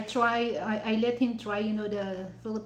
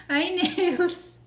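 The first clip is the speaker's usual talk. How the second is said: laughing greeting; 9 semitones higher, 7 dB louder than usual. Real, not acted. Greets viewer while laughing.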